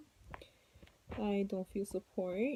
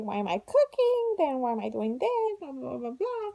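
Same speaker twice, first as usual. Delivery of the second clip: imitating mom; 5 semitones higher, 5 dB louder than usual. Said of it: "She's like".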